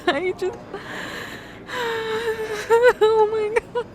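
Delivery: high, soft, tear-choked apology; weeping. Acted. Apologizing to her partner for the danger, weeping. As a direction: barely get the words out through weeping.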